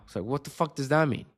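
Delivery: ordinary talk, nothing marked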